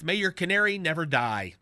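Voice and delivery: normal talking voice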